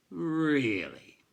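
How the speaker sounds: high, loud mock surprise; affected surprise. A narrator's reading. Bookseller mocks the boy with fake surprise, loud. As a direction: feign astonishment sarcastically. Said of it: "in affected surprise".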